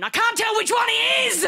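high, hammed-up yell; exasperated shout. Comic hating one unidentifiable man, hammed-up exasperated shout. Exasperated punchline yelled at audience.

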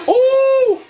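high, loud ooh; excited squeal. Real, not acted. Vlogger squealing at the dance. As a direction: squeal with delight. Reacting to the video.

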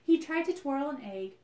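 ordinary delivery, nothing marked

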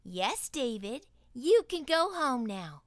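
high, loud stern teacher voice; scolding teacher. Acted. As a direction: scold him like a strict teacher. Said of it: "David's teacher always said".

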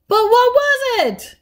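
very high, loud, drawn-out question; exasperated demand. A narrator's reading. Granddaughter pressing vague Granny, exasperated demand. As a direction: demand an answer at last.

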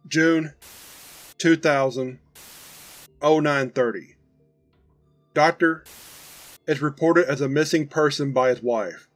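ordinary delivery

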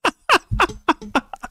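can't hold the laugh in; high laugh. Real, not acted. Laughing hard at the clip.

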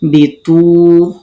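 বিতু said very slowly and loudly with a push; pushed pronunciation. Shown as a demonstration. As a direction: push the sound of বিতু out hard. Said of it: "ধাক্কা দিয়ে উচ্চারণ করতে হবে". (ধাক্কা দিয়ে উচ্চারণ)

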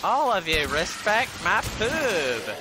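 shouted catchphrase. Acted. Gamer shouting catchphrase at enemies.

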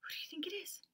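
high unvoiced teasing question; whisper. Teacher whispering the guessing question to kids. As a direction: whisper the riddle.

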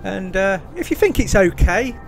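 usual speaking voice

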